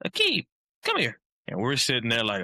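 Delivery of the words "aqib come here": much higher, put-on coach's voice calling a player over; Mangino impression. Coach calling Aqib over in a high put-on voice. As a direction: play the coach summoning Aqib in a high, odd voice. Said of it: "Your Mangino impression is hilarious".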